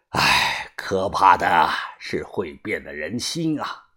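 low, soft, weary leader; sighing gang boss. A narrator's reading. Boss reveals the traitor to old master, grave sighing. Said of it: "把头叹了一声气".

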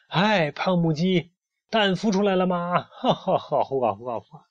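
mock her in singsong, then guffaw; jeering. A narrator's reading. Loudmouth teasing the sleepy hen, jeering. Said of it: "大嗓门在一旁嘲笑着".

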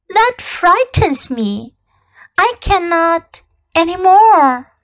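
put-on a timid child unable to obey; abashed murmur. A narrator's reading. Little prince ordered to yawn by the king, abashed. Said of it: "murmured the little prince, now completely abashed".

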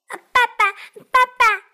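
put-on high, loud child cry of papa; excited duckling. Duckling shouting papa at the fox, high and loud. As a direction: squeal it out with joy.

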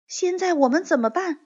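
put-on worried girl's question. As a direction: ask it as a worried young girl.

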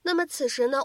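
ordinary talk

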